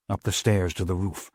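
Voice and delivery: plain reading voice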